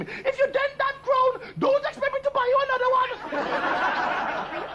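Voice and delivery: high, slow mock Queen screech. Mother telling off Prince Andrew, high mock screech. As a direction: shriek it like an outraged royal mother. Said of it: "his mother screaming at him".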